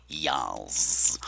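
low drawled campy yas; a diva's sassy yas. Real, not acted. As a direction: camp it up, deep and slow. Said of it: "campy yas".

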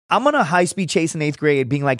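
usual speaking voice

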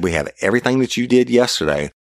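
high-pitched, emphatic accusation; retold confrontation. Officer telling suspect about evidence, retold confrontation. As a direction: lay out the evidence, pitched well up.

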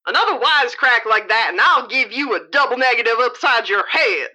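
fast, hard-edged guard voice; tough prison guard. Acted. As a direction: bark it like a mean warden.

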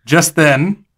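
normal talking voice

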